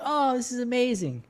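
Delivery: high, gushing fake enthusiasm; gushing delight. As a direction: squeal it like a happy feed. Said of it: "I'm like".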